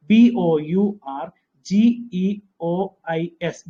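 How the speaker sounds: each letter said slowly and separately; teacher spelling out the word slowly. Shown as a demonstration. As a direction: dictate letter by letter. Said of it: "പഠിക്കേണ്ടത് ബൗർജിയസ്".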